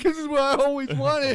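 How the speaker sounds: high, loud, hammed-up happy weeping; mock crying. Dude finds bag of crack at reveal, mock crying. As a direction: wail it like an overwhelmed homeowner. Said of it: "the dude starts crying like".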